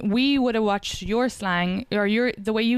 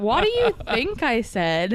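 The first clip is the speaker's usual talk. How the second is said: slow, higher incredulous question; shocked retort; 4 semitones higher, slower than usual. Retold or imitated. Replaying her reply to the offended comedian, shocked retort. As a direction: stretch it out in mock disbelief.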